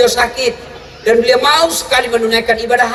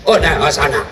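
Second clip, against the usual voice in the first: mock foreign voice, much lower; Italian man; 8 semitones lower than usual. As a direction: play the Italian pointing the way. (suara orang Itali pura-pura)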